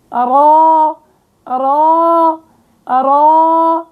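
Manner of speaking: أرا with a thick, emphatic ra; heavy ra demo. Shown as a demonstration. Teacher sounding out an emphatic ra. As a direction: draw out أرا from the narrowed throat. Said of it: "وقت بتقعر وسط اللسان".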